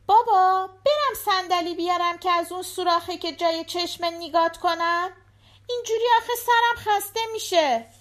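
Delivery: put-on high, eager child's voice; little girl voice. A narrator's reading. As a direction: play the small daughter at the door. Daughter voiced.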